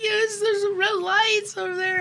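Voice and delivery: put-on high, slow, frightened child voice; scared kid. Frightened child points out the red lights to dad, high and pleading. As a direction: plead like a small kid who's sure he's right. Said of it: "I'm like".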